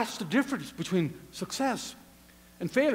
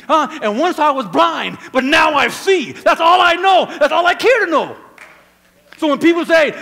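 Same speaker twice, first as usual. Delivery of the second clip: loud, emphatic testimony; raised voice, driving the story home to the congregation; fervent preacher testifying at volume; full-force preaching; 4 semitones higher, 8 dB louder than usual. Acted.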